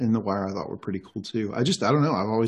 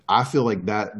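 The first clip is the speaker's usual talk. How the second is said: usual speaking voice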